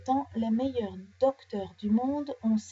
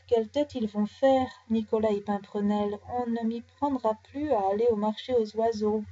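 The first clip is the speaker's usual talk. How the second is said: ordinary delivery, nothing marked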